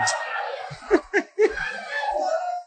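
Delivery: very high, drawn-out laughter; amused laugh. Real, not acted. Preacher joking about crawling babies eating things, long amused laugh. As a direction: let a long high laugh take over. Laughing at own childhood joke.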